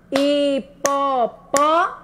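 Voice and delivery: HIPOPÓ in long, slow separate syllables. Shown as a demonstration. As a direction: sound out "Hipopó" slowly, leaving it unfinished. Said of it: "Tá faltando uma sílaba".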